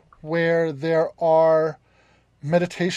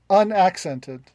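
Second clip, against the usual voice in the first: usual speaking voice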